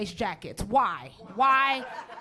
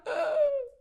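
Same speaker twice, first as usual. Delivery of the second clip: high mock sobbing gasp; hysterical gasp. Hysterical dog owner asked what her dog looks like, sobbing gasp. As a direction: suck in air like a hysterical wailer. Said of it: "She was hysterical".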